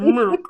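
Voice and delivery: milk said as "mirk", with an r-coloured vowel. Shown as a demonstration. Caller mimics the Memphis "mirk" for milk, an r-coloured vowel. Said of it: "I don't know where the R come from".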